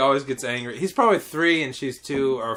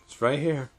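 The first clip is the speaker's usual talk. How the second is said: soft, lower, deadpan answer; low-key reply; 4 semitones lower, 7 dB quieter than usual. Retold or imitated. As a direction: say it quietly, unfazed. His own calm reply retold.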